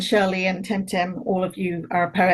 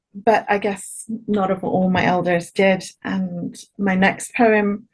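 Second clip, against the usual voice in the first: ordinary talk, nothing marked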